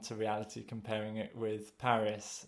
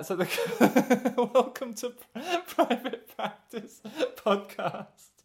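laughing; 12 semitones higher, 5 dB louder, much slower than usual. Real, not acted. Laughing through show intro.

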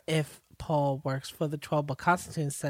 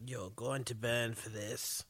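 menacing threat; 5 semitones lower, 9 dB quieter than usual. Retold or imitated. Mock villain threat.

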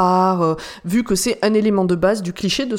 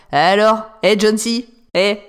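badly dubbed villain, a touch louder; movie villain; 4 dB louder, slower than usual. Shown as a demonstration. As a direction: ham up the bad guy. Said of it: "genre ça". (méchant de film mal doublé)

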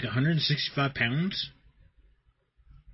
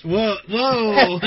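surprised laughing whoa; 7 semitones higher, 7 dB louder than usual. Real, not acted. Laughing shock at the story.

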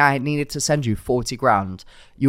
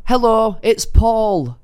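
high, louder phone greeting; pitched-up caller introducing himself; phone caller role; 10 semitones higher, 6 dB louder, much slower than usual. Retold or imitated. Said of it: "This guy says".

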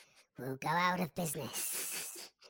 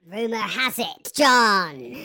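mock reveal; 6 semitones higher, 18 dB louder, slower than usual. Informant's dramatic reveal.

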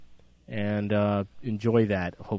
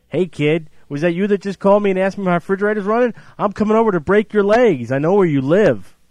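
angry threatening caller; 7 semitones higher, 6 dB louder than usual. Acted. Acted threat to prank caller.

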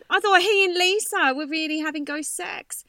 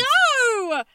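shocked; 10 semitones higher, 4 dB louder than usual. Real, not acted. Shocked reaction.

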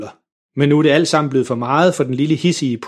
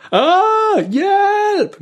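panicked cry for help. A narrator's reading. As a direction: scream as the car shoots off. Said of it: "når han lige at råbe". (faren råber i panik)

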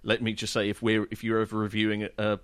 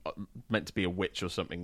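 normal talking voice

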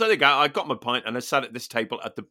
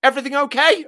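cheery; 11 semitones higher, 6 dB louder, faster than usual. Retold or imitated. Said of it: "looks at me and go".

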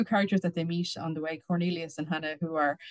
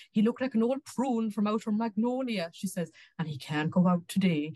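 higher-pitched, slow storytelling Irish voice; indignant Irish gossip; 4 semitones higher than usual. Acted. A reader quoting Miss Fancy Finnerty on a frog's sorry state, indignant gossip. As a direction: relish each scornful comparison. Reads a gossiping character's tirade.

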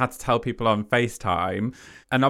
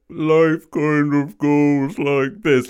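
slow, louder, drawn-out slow-motion voice; 5 semitones higher, 6 dB louder, much slower than usual. Shown as a demonstration. Telling the host how a proposal feels, slow-motion delivery. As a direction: stretch every word as if time stopped. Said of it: "it's really slow motion".

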